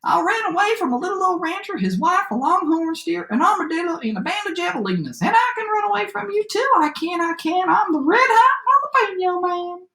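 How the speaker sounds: high-pitched gingerbread cowboy voice; boastful cowboy shout. A narrator's reading. Gingerbread cowboy brags to the animal chasing him, high boastful shout. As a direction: crow it high, like a bragging runaway. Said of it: "he shouted".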